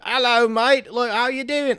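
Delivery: loud, high, hammed-up British accent. Singer greeting someone, exaggerated British accent. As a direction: shout it in a comic British accent. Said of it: "why does [name] sound like ... you talk to her, she's like".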